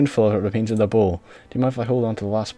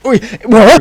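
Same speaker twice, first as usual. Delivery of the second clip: high, loud baffled rant; loud confused outburst; 15 dB louder than usual. Real, not acted. Streamer shouting baffled about the cook-off timer. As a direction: yell your confusion.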